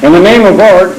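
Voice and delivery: ordinary talk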